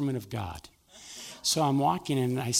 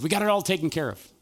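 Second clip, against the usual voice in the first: overeager reassurance; 5 semitones higher, 8 dB louder than usual. Retold or imitated. Manager overeager, cleaning enthusiastically.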